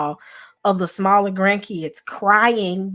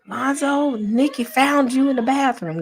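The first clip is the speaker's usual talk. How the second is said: mama calling out; 7 semitones higher than usual. Retold or imitated. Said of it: "when my mama said".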